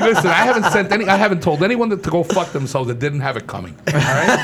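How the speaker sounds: loud, high laughing. Real, not acted. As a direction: laugh through the words. Jokey defence, laughing.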